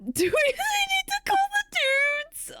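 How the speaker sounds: high squealing laugh-shout; sudden high laughing squeal. Real, not acted. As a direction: shriek it through a laugh. Laughing mock shout at cat.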